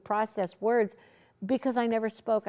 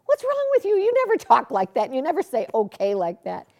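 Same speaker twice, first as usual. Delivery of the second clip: incredulous; 4 semitones higher, 6 dB louder than usual. Retold or imitated. Confronting Oral's odd reply.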